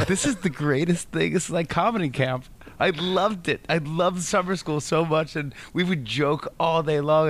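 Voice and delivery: high, giddy and laughing, lost in the memory; delighted high gushing; giddy recalled joy. Retold or imitated. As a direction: gush like a kid who found his tribe. Gleeful summer school memory.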